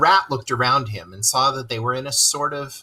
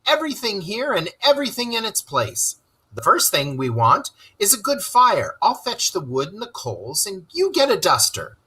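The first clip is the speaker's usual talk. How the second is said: cheery; 8 semitones higher than usual. Acted. Rat cheerfully bustling about.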